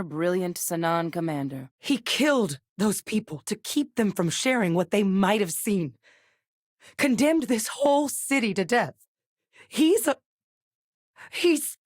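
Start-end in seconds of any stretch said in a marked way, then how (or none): 1.8-11.8 anger building, pitch climbing higher; heated accusation climbing to a high, cut-off burst; rising outrage. Acted. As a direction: condemn him, getting more worked up until words fail. Character denounces a killer, breaks off.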